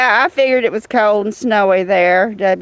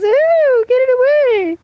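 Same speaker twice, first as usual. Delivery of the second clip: high squealing ew; mock girly girl; 13 semitones higher than usual. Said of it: "girly girls who sees a snake and says".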